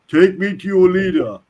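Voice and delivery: hammed-up insistence from an alien commander; alien captain. Alien repeats the demand to the gas pump, insistently. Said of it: "The alien repeats".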